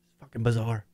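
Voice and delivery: ordinary talk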